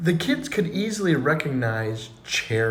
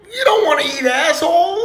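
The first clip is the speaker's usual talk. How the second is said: high, loud, drawn-out panicked scream; 13 semitones higher, 7 dB louder, slower than usual. Acted. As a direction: scream it out like a frantic kid warning everyone. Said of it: "Little Johnny screams".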